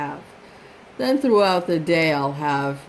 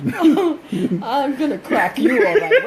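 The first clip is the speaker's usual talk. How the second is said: laughing mock threat; 7 semitones higher, 4 dB louder, slower than usual. Mom playfully threatens son.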